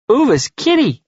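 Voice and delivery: put-on high little-kid voice, utterly sure; very high, emphatic small child; certain three-year-old. A narrator's reading. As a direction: pipe up like a toddler stating a fact. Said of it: "three-year-old answers with rock-solid certainty".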